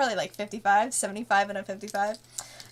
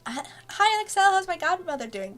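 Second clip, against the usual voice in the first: bright greeting; 9 semitones higher than usual. Retold or imitated. Voicing Sabo greeting Excel.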